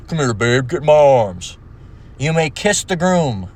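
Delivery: put-on voice of a mannish bride; mock burly bride. Masculine bride carrying her man over the threshold, mock burly voice. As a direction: play a burly bride claiming the groom.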